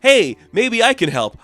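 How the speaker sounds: high, loud and slow; eager helpful chirp; chirpy Harley Quinn. Retold or imitated. Harley Quinn offering Elvira help, high and eager.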